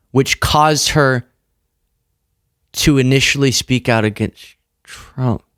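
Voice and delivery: slow, spelled-out circular logic; slow, deliberate. Shown as a demonstration. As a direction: lay it out step by step, slowly. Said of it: "if I am to believe him correctly".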